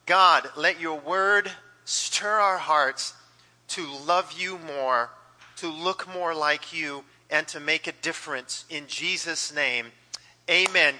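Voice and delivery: slow, softening prayer voice. Acted. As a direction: pray it gently and unhurried. Pastor prays.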